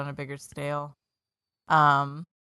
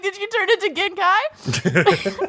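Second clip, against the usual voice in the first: laughing question; 12 semitones higher, slower than usual. Retold or imitated. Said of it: "So I'm like".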